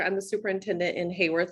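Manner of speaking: ordinary talk, nothing marked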